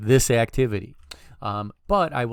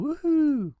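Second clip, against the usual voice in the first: high squeal of delight; excited cheer. Real, not acted. Celebrating code that works.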